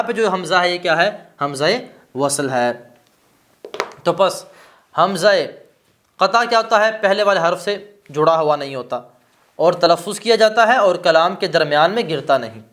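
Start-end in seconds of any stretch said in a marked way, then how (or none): none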